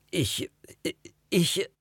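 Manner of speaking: halting, high stammer with long gaps. A narrator's reading. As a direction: struggle to get the word out. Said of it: "stotterte ich". (stotternd, hoch und stockend)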